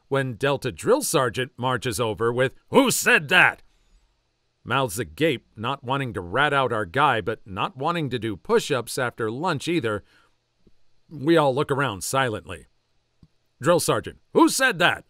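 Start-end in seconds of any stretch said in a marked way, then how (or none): 2.7-3.6 loud, higher demand; angry drill sergeant shout; drill sergeant yell. Acted. 14.3-15.0 loud, higher demand; angry drill sergeant shout; drill sergeant yell. Acted.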